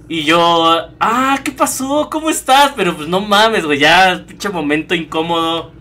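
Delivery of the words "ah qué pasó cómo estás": greeting her while heartbroken, forced cheer; forced cheerful greeting. Retold or imitated. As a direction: fake a bright hello while upset. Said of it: "tratando como de sonreír".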